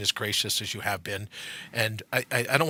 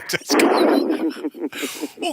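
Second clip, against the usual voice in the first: laughing echo; 3 semitones higher, 7 dB louder, much slower than usual. Real, not acted. Echoing guest's line through laughter.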